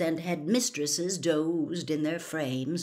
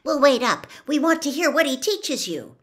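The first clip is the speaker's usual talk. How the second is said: put-on higher, louder, eager girl; bright and keen, wishing him well; 9 semitones higher, 8 dB louder than usual. A narrator's reading. Hermione sends Harry off to Dumbledore, eager.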